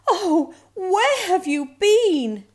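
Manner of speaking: high, slow, loud cry of relief; worried mother. A narrator's reading. Mother finds lost cub, high worried cry.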